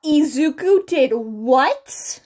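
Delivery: loud, slow stunned question; can't believe what he just heard; disbelief. A narrator's reading. Hazashi shocked by the wreckage.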